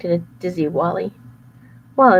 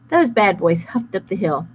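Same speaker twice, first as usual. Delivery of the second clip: usual speaking voice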